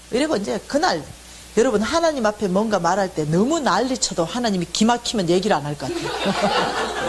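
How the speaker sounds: usual speaking voice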